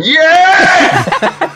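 long, high-pitched crowd yell; screaming fan yell. Retold or imitated. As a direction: holler it like a fan from the back garden. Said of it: "like, going".

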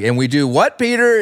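Peter? jumps way up in pitch; prompting question. Retold or imitated. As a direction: put him on the spot, pitch leaping up on Peter?. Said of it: "And he's like".

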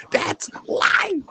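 hushed rapper voice imitation; unvoiced [name] impression. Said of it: "In my [name] voice".

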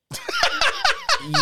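high squealing laugh; a shrieking laugh that bursts out; high burst of laughter. Real, not acted. Comic recalling being remembered, high shrieking laugh.

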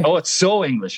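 Australian attempt that lands very English; failed Australian accent. Shown as a demonstration. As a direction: try Australian and come out English. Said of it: "That is very English".

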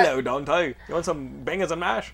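hammed-up British bloke; bad British accent. Host imitates a British actor offering bangers and mash, bad British accent. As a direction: greet them in a badly faked British accent. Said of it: "That's my British accent".

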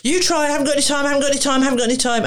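high, fast, anxious pleading; anxious protest. Retold or imitated. Parent protesting to the therapist, high and anxious. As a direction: rattle it off in a panic about time. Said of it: "I can hear the anxiety in them".

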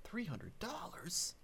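soft, slow, high incredulous question; hushed disbelief. Real, not acted. Co-host hearing the TV deal, hushed disbelief. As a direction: repeat it in awed, hushed amazement. Amazed at a TV price.

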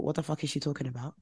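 quick, very soft disbelief under the breath; baffled aside. Retold or imitated. Viewer recalling her reaction to the friend's advice, baffled aside. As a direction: think it aloud, hushed and puzzled.

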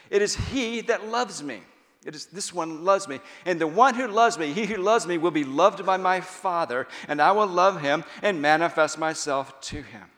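loud, high, ringing sermon voice; impassioned pulpit delivery; full-force preaching. Acted. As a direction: proclaim the words of Jesus to the crowd.

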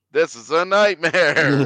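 slow, high, loud mock wail; dramatic despair. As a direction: wail it like an outraged fan. Mock fan reaction quote.

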